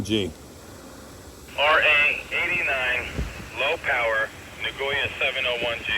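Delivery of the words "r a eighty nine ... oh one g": heard through a radio; as if over a handheld radio; over walkie talkie. Shown as a demonstration. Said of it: "radio test over the air".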